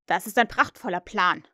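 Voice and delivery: loud, emphatic approval; calling out approval. A narrator's reading. Hook seizes on Smee's plan, loud approval. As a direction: proclaim it loudly. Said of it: "rief Hook".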